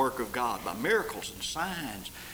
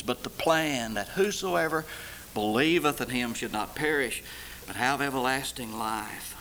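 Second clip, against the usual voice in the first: usual speaking voice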